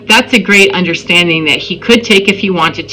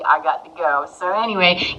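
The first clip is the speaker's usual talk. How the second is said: normal talking voice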